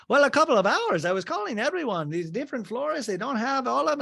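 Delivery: high, bright mock gushing; imitating Adriana. Adriana offering Larsa an olive branch, high and gushing.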